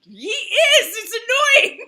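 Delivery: high, loud laughing "He is!"; mock outrage. Real, not acted. About cousin-in-law who makes mead, laughing mock outrage. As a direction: fake indignation, laughing.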